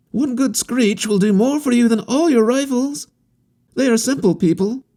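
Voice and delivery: put-on an eager young wanderer reassuring; harlequin youth. A narrator's reading. Harlequin assuring the captain about the natives, high and earnest.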